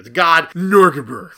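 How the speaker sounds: numb mouth from Novocaine; numb from the dentist. Real, not acted. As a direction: mumble through a numb lip. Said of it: "[name], you, you okay?".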